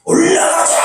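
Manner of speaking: high-pitched mock rallying cry; revival preacher's cry. Visiting revivalist runs across the platform, crying out high. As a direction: imitate a fired-up revivalist calling out. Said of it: "막 돌아다니며 뛰어다니면서".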